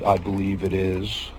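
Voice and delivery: ordinary talk, nothing marked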